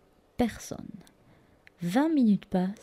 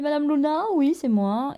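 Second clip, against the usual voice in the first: nurse calling; 7 semitones higher than usual. Retold or imitated.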